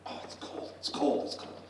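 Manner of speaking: hushed, breathy complaint of cold; acted shivering. Professor coming in from the cold to his bar, hushed shivering. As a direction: mutter it under your breath, freezing.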